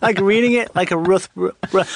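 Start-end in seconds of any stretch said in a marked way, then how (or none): none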